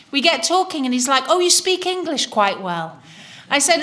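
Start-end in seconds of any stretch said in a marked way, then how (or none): 1.3-3.0 higher-pitched, condescending compliment; patronizing imitation. Seatmate complimenting a presumed foreigner, patronizing. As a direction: praise them as if amazed they manage it.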